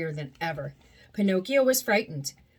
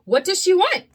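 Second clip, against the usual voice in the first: curious puppet; 9 semitones higher, 7 dB louder than usual. Acted. Pinocchio asking about the witch.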